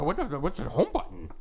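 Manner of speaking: put-on puzzled old-timer fumbling with tech; baby boomer voice. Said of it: "that's my baby boomer voice now".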